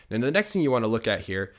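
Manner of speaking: ordinary talk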